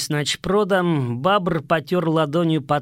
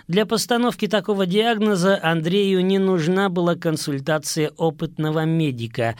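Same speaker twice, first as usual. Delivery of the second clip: ordinary delivery, nothing marked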